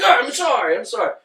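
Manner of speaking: high-pitched mock apology; retold flustered apology. Imitating someone apologising after a threat, high and flustered. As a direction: pitch it up, imitating a hurried sorry. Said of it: "And then she was like".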